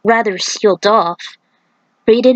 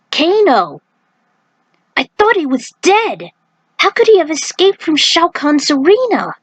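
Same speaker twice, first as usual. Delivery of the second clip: raised, startled voice of disbelief; shocked outcry; 8 semitones higher than usual. Acted. Princess shocked to see Kano alive, high startled cry. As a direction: gasp it out as if seeing a ghost.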